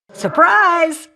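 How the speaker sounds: high, loud gleeful shout of surprise; spring it on them with a cackling glee; bright playful battle cry; gleeful ambush cry. Acted.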